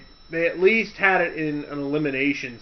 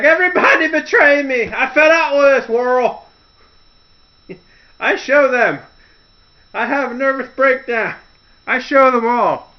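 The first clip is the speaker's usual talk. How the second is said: high, loud, hammed-up broken-English rant; Tommy Wiseau; 8 semitones higher, 7 dB louder than usual. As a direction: overact a betrayed melodrama. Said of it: "she totally goes Tommy Wiseau".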